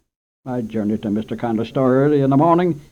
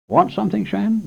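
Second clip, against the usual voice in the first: higher, lightly voiced old storekeeper; 11 semitones higher than usual. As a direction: peer over glasses at the boy. Storekeeper addresses boy.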